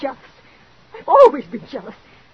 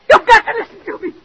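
higher and louder, begging; desperate plea; 11 semitones higher, 7 dB louder than usual. Acted. Mrs Bradley begging Mr Rogers for pity, desperate plea. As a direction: cry out for pity.

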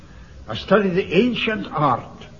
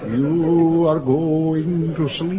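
slow, drawn-out hypnotic intoning; mock hypnotist, stretched words; much slower than usual. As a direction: lull him under, slowly. [name] hypnotizes Jones.

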